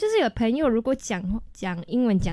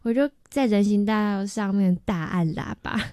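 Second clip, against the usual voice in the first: usual speaking voice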